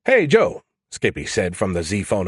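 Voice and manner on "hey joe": high, loud cheery call; pitched-up, pushy hail; chirpy AI voice. A narrator's reading. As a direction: pipe up brightly as a cheeky AI. AI Skippy calls out teasingly.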